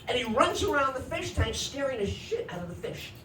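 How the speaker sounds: normal talking voice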